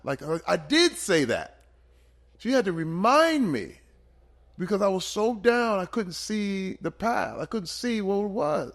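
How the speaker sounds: fighting back tears while remembering; choked up, real. Real, not acted. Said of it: "I'm getting choked up thinking about it".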